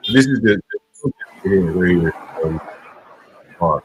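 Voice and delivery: muffled, like through a wall; muffled mic. Real, not acted. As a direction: voice dulled by a bad mic. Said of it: "your mic still sounds, like, muffled".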